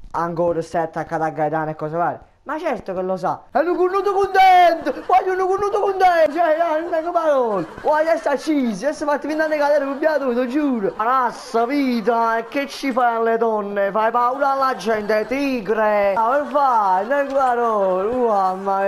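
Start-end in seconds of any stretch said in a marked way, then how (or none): 3.5-10.9 high, loud mock-jealous yelling; hammed-up rant. 10.9-18.9 loud. Acted. Yelling back at the ranter.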